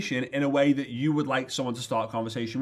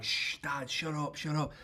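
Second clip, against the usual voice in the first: much softer, hushed mock whisper; urgent hushing; 9 dB quieter than usual. Son hushing his noisy dad in the cinema, hushed. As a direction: shush him under your breath.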